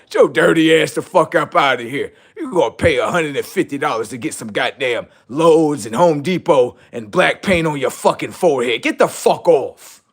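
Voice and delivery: loud, furious yelling; shouting down the fake humans, enraged; angry rant. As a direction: chase them out in a rage.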